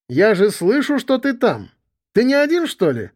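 high, calling through a closed door. Acted. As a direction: shout from behind a door. Кричит из-за двери. (крик из-за двери)

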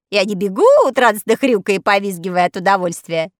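plain reading voice

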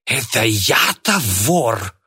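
put-on drawn-out, mildly outraged old man's cry; indignant cry. A narrator's reading. Accused old man protests, crying out indignantly. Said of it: "возмущённо завопил Кайратов".

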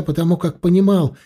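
plain reading voice

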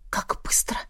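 hushed, voiceless aside; alarmed whisper. A narrator's reading. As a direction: whisper it to yourself in dismay. Narrator's startled thought as alarm rings. (встревоженный шёпот)

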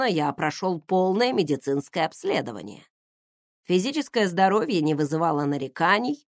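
ordinary delivery

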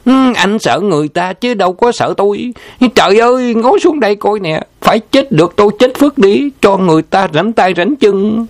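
shrill wife railing at her husband; wife yelling. A narrator's reading. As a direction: wail at him as if the whole camp should hear. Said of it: "giọng người đàn bà lại quăng quát".

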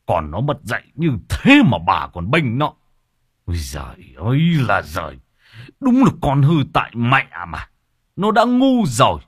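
scolding father. Acted. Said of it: "trợn mắt nhìn vợ".

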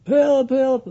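high, loud shouted help; mock cry for help. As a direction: yell for help like someone in trouble in the street. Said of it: "als iemand gaat, eh, gillen".

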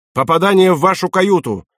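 acted midshipman's report; urgent report. A narrator's reading. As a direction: call the hit out over the battle. Midshipman calls out damage report.

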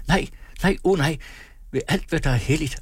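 high, pleading protest; urgent interruption. A narrator's reading. Sofie cuts Møller off, urgently protesting. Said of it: "afbrød Sofie ham".

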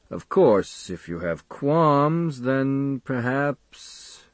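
slow, fading, regretful doctor; trailing off sadly. Acted. Doctor hints he may drop the case, trailing off sadly. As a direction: draw it out and let it fade sadly. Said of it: "His voice trails off sadly".

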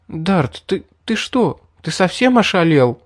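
put-on high, halting, stunned girl; stammering. A narrator's reading. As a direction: stumble over the words in disbelief. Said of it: "запинаясь, спросила пришедшая в себя Мэри". (запинаясь, ошеломлённо)

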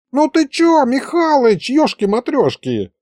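put-on high, indignant old wizard; offended old wizard. (обиженный старый колдун, высоким голосом)

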